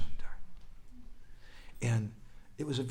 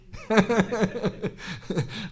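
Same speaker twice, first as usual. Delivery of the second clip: high, louder laugh; 10 semitones higher, 5 dB louder than usual. Real, not acted. Preacher rejoicing over Janmashtami, laughing. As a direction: burst of laughter after an exclamation.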